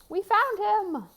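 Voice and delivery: high, slow, loud cheer; hammed-up joyful cry; triumphant men. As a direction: shout like men who struck gold. Five men find their bull.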